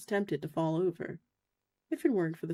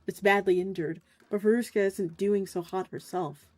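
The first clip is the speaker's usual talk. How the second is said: ordinary talk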